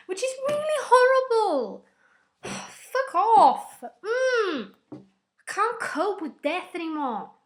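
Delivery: high, loud wail of grief; upset cry. Real, not acted. Upset fan wailing about the death. Distraught over a character's death.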